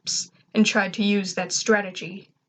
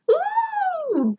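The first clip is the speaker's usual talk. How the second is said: squeaky, much higher cheer; excited robot squeal. A narrator's reading. Little robot Gur cheering at the news, excited high squeal. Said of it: "Gur said excitedly".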